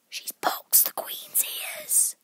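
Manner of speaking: very high, slow whispery voice; high, breathed gossip; secretive rabbit. A narrator's reading. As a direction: confide it in a squeaky whisper. Rabbit confides a scandal.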